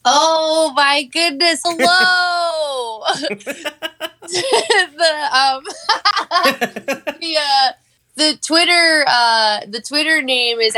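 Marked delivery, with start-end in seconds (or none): 0.1-9.0 laughing delighted greeting; excited. Real, not acted. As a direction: gush with surprise. Guest introduced, delighted.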